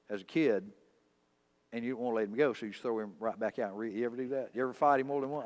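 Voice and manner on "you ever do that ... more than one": normal talking voice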